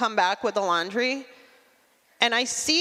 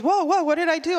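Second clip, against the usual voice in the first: mock alarmed husband, higher pitch; a higher, startled "whoa, whoa"; 8 semitones higher, 3 dB louder, faster than usual. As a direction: voice the husband jumping up defensively. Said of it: "And he goes".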